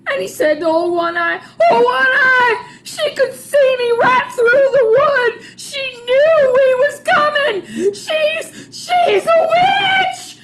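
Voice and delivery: high, loud panicked wailing; frightened robber shrieking to his boss; terrified shriek. Acted. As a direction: scream it out like a fleeing coward. Terrified robber runs back.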